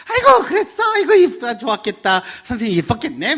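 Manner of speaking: very high, loud, gushing response; excited matching reply. Retold or imitated. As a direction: gush back at the chattering daughter. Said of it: "나도 썰썰썰썰 하고 대답해". (딸의 수다에 높고 신나게 맞장구치는 엄마)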